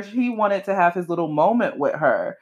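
normal talking voice

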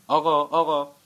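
high, very loud calling; hailing a taxi. A narrator's reading. Man running after taxis in the rain, shouting. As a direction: call out urgently to a passing car.

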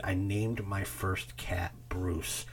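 ordinary talk, nothing marked

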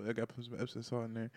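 low, hushed mock sweet-talk; softly butter her up in a low voice; coaxing boyfriend. Boyfriend pampering her before bad news, mock tender.

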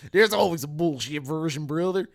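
loud mock tough-guy voice; hammed-up taunt. Teasing a friend about his version, hammed up loudly. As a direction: boom it out in a put-on voice.

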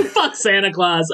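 laughing. Real, not acted. GM echoes, laughing.

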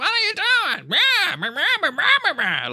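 very high, squawking complaint; mock whiny yelling. Annoying character yelling and complaining all the time, mocking shrieky imitation. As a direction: imitate a nagging yeller, dissolving into nonsense. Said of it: "yells and complains all the time".

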